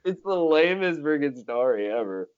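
laughing. Real, not acted. Laughing at own story.

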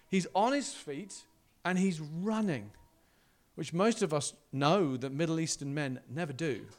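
ordinary delivery

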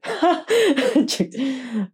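laughing through the words, slow and loud; cracking up at her own line. Real, not acted. Narrator cracks up at the character's lie, laughing loudly. Proud of tricking Frank.

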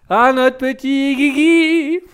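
slow, high and loud theatrical moan; mock dramatic sigh. Teasing a chat viewer, drawn out high and loud. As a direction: sigh over the name like a tragedy.

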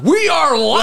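excited shout. Pretend. Excited exclamation.

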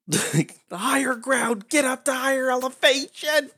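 yelling. Acted. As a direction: yell it out to everyone. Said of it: "I just begin yelling at everyone".

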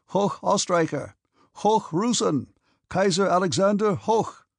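high, hearty German toasts; cheering. A narrator's reading. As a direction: cheer each one out like a hurrah. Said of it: "quoting words often repeated by the German landlord".

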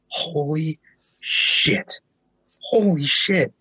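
breathless, half-voiced stunned awe; awed disbelief. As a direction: gasp it out like you can't believe your eyes. First seeing Slipknot live.

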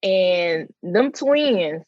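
normal talking voice

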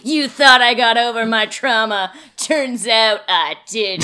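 loud, hammed-up gotcha, slowing at the end; theatrical reveal on the edge of laughter; mock triumphant reveal. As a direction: play a gloating movie character for laughs. Hams up [name]'s reveal, laughing.

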